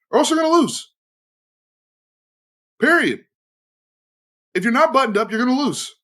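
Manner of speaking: final loud warning, period; emphatic warning. Real, not acted. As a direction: slam the point home. Hammering home the warning.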